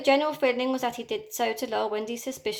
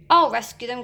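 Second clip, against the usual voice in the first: cried; 2 semitones higher, 7 dB louder than usual. A narrator's reading. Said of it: "cried Peter".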